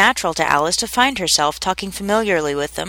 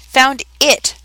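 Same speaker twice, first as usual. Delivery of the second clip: a mildly cross, higher snap of the words; reply with mild irritation; 6 semitones higher, 4 dB louder than usual. A narrator's reading. The mouse answers the duck's interruption mildly cross. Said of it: "the mouse replied rather crossly".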